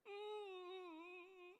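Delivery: high, soft wailing whimper; mock crying hum. Shown as a demonstration. Comedian demonstrates women's crying tune, mock crying hum. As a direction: hum a long, high fake sob. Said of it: "આ, આ સૂર છે".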